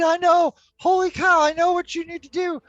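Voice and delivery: usual speaking voice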